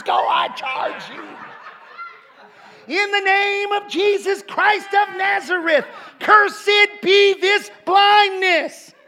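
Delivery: very high, loud rebuking prayer; mock faith healer commanding, slow and roaring; revival preacher shout. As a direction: cast out the devil of blindness, drawn out. Imitating Alan's healing prayer.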